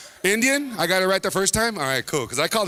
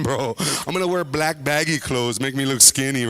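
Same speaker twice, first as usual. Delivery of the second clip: ordinary talk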